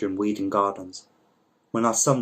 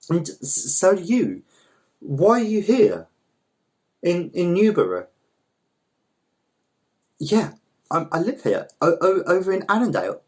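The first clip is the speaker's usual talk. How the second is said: flustered; 8 semitones higher, 4 dB louder than usual. Acted. Flustered reunion chat.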